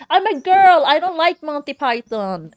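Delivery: loud, high, drawn-out mock declaration; mock cartoon quote. Fan quoting a Family Guy gag about Monty Python, loud mock voice. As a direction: belt it out high like a cartoon character. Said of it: "Family Guy where they're like".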